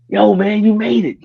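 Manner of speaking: high, anxious mock greeting; nervous wreck. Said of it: "He's nervous wreck".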